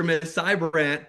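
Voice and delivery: usual speaking voice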